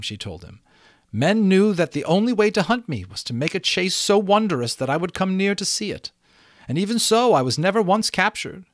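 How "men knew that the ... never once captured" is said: high, lofty storytelling voice; proud unicorn. A narrator's reading. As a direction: boast of your escapes in a raised pitch.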